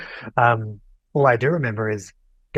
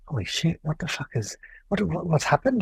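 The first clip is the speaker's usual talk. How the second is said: alarmed whisper-ish; 6 semitones higher, 4 dB quieter than usual. Retold or imitated. Finds mum crying in bed.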